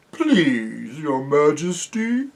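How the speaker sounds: slow, pleading bear voice, rising at the end; thick, drawn-out creature voice; sleepy bear. A narrator's reading. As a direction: beg politely as a drowsy bear. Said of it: "said the bear".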